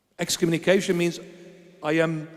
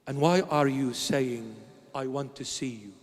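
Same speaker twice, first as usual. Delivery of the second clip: voice of the Lord; 6 semitones lower, 6 dB quieter than usual. Retold or imitated. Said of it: "He said".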